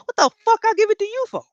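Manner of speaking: fast, high indignant comeback; mock outrage at the passport excuse; incredulous retort. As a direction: fire it back in disbelief.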